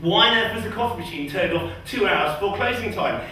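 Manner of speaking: ordinary talk, nothing marked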